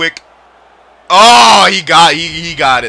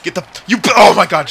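ordinary delivery

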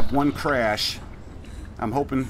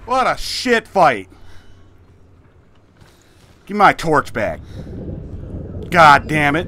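angry cursing; 4 semitones higher, slower than usual. Real, not acted. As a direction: swear at the screen. Lost the fight, cursing.

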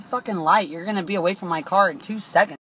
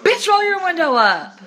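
much higher, loud mock yell; sassy shout; 7 semitones higher, 6 dB louder than usual. Performing her comeback to the driver, high loud yell. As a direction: fire it back at her. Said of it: "It's like".

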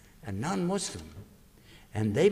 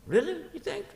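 much higher, surprised question; surprised disbelief. Acted. Brother told he's missed, surprised. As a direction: lift it up in disbelief. Brother doubts the good news.

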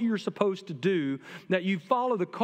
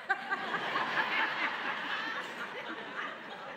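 high laugh; 13 semitones higher, 7 dB quieter than usual. Real, not acted. Preacher laughs at own quote.

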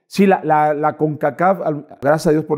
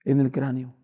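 tearful wife; 3 semitones higher, 6 dB quieter than usual. Retold or imitated. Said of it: "Me habla mi esposa con lágrimas".

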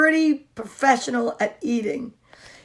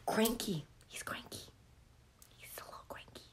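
very soft, secretive whisper; 4 semitones lower, 23 dB quieter, faster than usual. Real, not acted. Reader confiding about the cranky dog, whispered.

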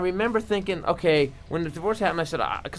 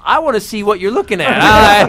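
loud eager demand; 2 semitones higher, 10 dB louder than usual. Retold or imitated. Man caught, suddenly curious.

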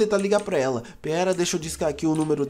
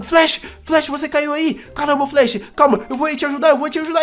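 higher, louder cry of alarm; alarmed shout; 5 semitones higher, 4 dB louder than usual. Pretend. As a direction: yell as your friend drops into the hole. Friend falls through trapdoor.

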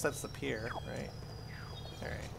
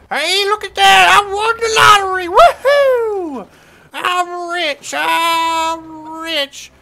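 very high, loud whooping cheer; thrilled shout; 11 semitones higher, 14 dB louder, slower than usual. Acted. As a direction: yell it out like you just hit the jackpot. Wins the lottery in game.

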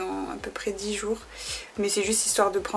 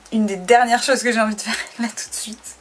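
laughing; 5 dB louder than usual. Real, not acted. Laughs at her reluctance.

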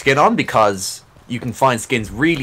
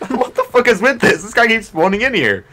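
much higher, incredulous outburst; startled disbelief; 11 semitones higher, 3 dB louder than usual. Real, not acted. Player to teammate as a killed enemy keeps respawning, startled disbelief. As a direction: exclaim in high-pitched exasperated disbelief.

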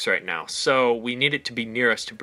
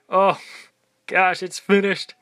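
excited; 7 semitones higher, 5 dB louder, slower than usual. Real, not acted. Finishing the map build.

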